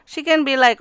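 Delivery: ordinary talk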